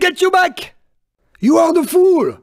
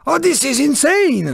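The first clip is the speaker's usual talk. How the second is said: normal talking voice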